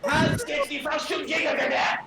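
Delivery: high-pitched shouted German accent; mock German accent. Shown as a demonstration. Joining the German accent demo, high mock German shout. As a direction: call it out in a put-on German accent. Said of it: "how to do a German accent".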